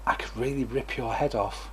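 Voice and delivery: soft, sweet tone on nasty words; quiet, lovely-sounding threat; as if saying something lovely. Shown as a demonstration. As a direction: deliver the threat gently, like a compliment. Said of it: "like they're saying something lovely".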